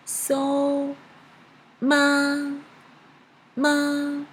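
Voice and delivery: "ma" held long on one high, level pitch; high flat tone. Shown as a demonstration. Teacher demonstrating first tone for learners, high flat "ma". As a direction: sustain "So" and "ma" high without any movement. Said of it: "It is very high and flat".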